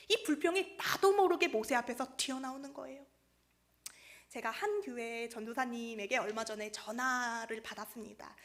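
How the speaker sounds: ordinary delivery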